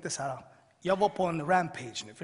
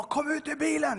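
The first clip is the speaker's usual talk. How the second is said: raised, high-pitched police command; shouted order; 10 semitones higher, 5 dB louder than usual. Retold or imitated. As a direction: call it out to someone inside a car. Police knock and order him out. (ropad polisorder, högt tonläge)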